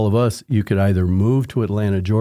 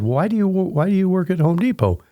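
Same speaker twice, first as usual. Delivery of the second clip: curious question; 10 semitones higher than usual. Retold or imitated. Asking the coworker, curious.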